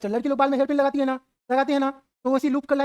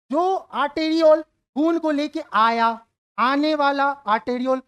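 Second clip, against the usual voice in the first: slow explanation; 2 semitones higher, slower than usual. Shown as a demonstration. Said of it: "teacher slows for students".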